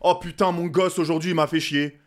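escalating comedy rant. Acted. Escalating rant voicing the liar.

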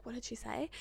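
hushed, soft whisper from dad; dad quietly whispering to his daughter at check-in; imitated whisper. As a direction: lean in and whisper the question.

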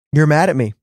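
lightly voiced, matter-of-fact statement; Holy Spirit quoted. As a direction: say it as a simple, knowing statement. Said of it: "the Holy Spirit said".

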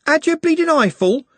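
very high, loud indignant challenge; indignant Juliet. A narrator's reading. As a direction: tear into him, high-pitched. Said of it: "in a voice like pearls".

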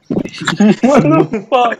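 shocked. Real, not acted. Shocked reaction to a crude question.

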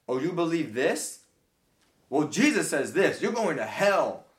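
loud, high, sneering and smug, slowing at the end; snobby mock preacher. Imitating a snobby believer condemning someone, loud sneering mockery. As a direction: lord it over them from your high horse. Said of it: "being all snobby and being like".

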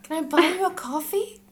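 high, loud gushing crush voice; asking someone out. Pretend. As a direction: ask them out all starry-eyed. Gushing about the writer.

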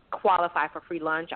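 ordinary talk, nothing marked

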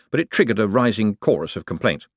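plain reading voice

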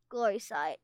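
ordinary delivery, nothing marked

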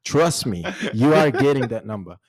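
loud, high and emphatic; hyped-up promise. Real, not acted. Hyped promise to guys chatting up girls. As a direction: sell it with big confidence. Hyping up a friend.

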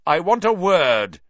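loud, high, furious demand; furious shout. Acted. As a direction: bark it out in rage. Snape shouting for Lupin.

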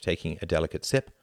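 ordinary delivery

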